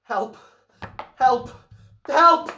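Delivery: high, very loud screams, building; screaming for help. Acted. Stabbed man cries for help.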